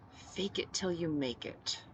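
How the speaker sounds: soft, slow, distant remembered words; a quiet, slow voice echoing in her mind. Acted. She hears Kitten's advice in her head, soft and slow. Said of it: "Kitten's voice sounding clearly".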